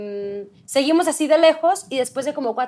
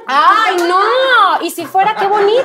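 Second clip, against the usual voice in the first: loud protest; 7 semitones higher, 8 dB louder, slower than usual. Real, not acted. Defends chubby cheeks.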